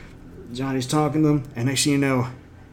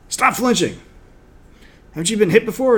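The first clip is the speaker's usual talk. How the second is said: barking sensei; 8 semitones higher, 5 dB louder than usual. Retold or imitated. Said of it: "And he's like".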